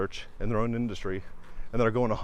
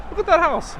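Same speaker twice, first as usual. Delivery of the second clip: much higher and louder, wowed by the house; excited; 7 dB louder than usual. Real, not acted. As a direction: point it out in delight. Spots house while driving.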